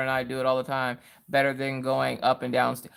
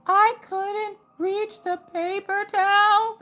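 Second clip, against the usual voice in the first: put-on much higher, slow whine of a little kid; imitating small child; 4 dB louder, much slower than usual. Mom quoting her daughter's excuse in a high, slow childish whine. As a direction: drag it out like a toddler's excuse. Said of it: "And then you'll be like".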